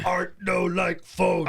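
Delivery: slow, dim-witted caveman voice; caveman talk. Pretend. Joking about Art smashing the phone, slow caveman talk. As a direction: say it like a simple brute.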